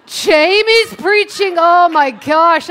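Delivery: loud, high, drawn-out complaint; hammed-up dismay, slow and loud; mock exasperated outburst. Congregant grumbling about today's preacher, mock exasperation.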